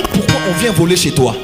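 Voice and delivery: ordinary talk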